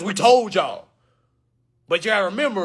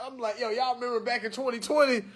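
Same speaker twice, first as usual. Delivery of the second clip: ordinary talk, nothing marked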